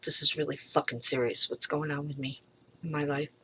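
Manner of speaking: ordinary talk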